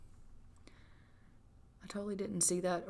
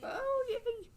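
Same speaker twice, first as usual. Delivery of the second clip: much higher squeaky yay; excited squeal. Real, not acted. Player squealing as her Sim goes into labor. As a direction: squeal with delight. Baby arriving, labor begins.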